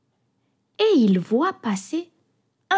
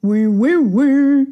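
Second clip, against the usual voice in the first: louder shouting baker; loud puffing baker yes; 2 semitones higher, 6 dB louder, slower than usual. A narrator's reading. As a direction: call out as the running baker. Baker chasing, third time.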